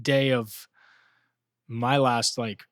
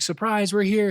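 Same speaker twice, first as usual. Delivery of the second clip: higher imitation of an eager mom; raised, excited mom voice; Megan; 8 semitones higher than usual. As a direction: sing out a surprise to a child. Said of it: "being like".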